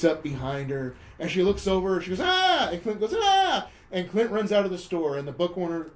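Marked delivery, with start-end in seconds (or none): 2.2-2.7 short high yelp, suddenly louder; mock startled scream. Woman startled by Clint sneaking up, yelping. 3.2-3.7 short high yelp, suddenly louder; mock startled scream. Woman startled by Clint sneaking up, yelping.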